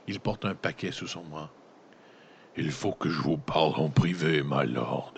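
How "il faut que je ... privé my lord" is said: lightly voiced captain, lower and hushed; a hint of a grave captain asking for privacy; captain speaking low to his passenger. A narrator's reading. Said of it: "de sa voix basse".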